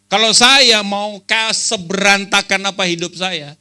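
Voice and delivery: loud, high, driving sermon voice; fiery preaching. Real, not acted. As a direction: preach at full force to the congregation. Preaching at full force.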